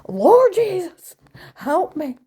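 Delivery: loud, high, slow cry of Lord Jesus; hammed-up holy scream; mock screaming. Mother screaming in Walmart, loud high mock cry. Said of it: "Walmart, screaming".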